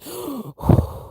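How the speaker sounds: sharp frightened gasp for air; panicked gasp. Retold or imitated. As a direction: imitate panic breathing. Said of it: "I was breathing like".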